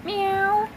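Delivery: imitated cat meow.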